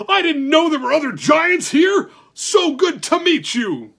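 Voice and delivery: booming, high, joyful giant voice; a big delighted giant greeting new friends; jolly giant. Acted.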